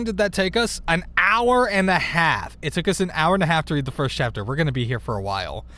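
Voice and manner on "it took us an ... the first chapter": ordinary delivery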